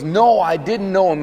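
an insistent acted denial; loud denial. Peter's third denial, retold.

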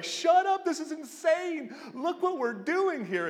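very high, loud screaming joy; mock angel scream of joy; excited scream. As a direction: angels losing it with delight. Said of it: "they're screaming like".

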